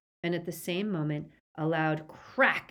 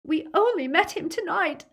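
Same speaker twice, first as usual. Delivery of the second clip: high, distressed protest; tearful, high-pitched denial; sobbed plea; 12 semitones higher, 7 dB louder than usual. A narrator's reading. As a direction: plead tearfully under torture. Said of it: "Hermione sobbed".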